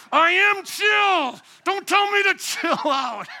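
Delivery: high, loud, drawn-out protest of being calm; comic exasperated shout; mock angry yell. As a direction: yell it as if insisting you're calm. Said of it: "I'm calm while I'm yelling".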